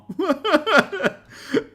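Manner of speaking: long, high-pitched burst of laughter; helpless giggling fit; real cackling laugh. Real, not acted. Commentator cracking up at mugshots dot com, laughing.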